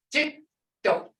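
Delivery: Jito with both consonants sounded; Jito, the tongue touching behind the front teeth; double consonant jito. Shown as a demonstration. Teacher shows students how to say Jito clearly. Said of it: "Listen now".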